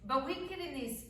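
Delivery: ordinary delivery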